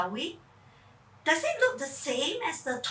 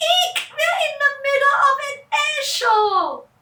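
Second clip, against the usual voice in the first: much higher, louder, shrieking; frightened shriek; 13 semitones higher, 6 dB louder than usual. Acted. Charlene startled by jets all around, shrieking. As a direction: squeal in alarm. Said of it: "Charlene said".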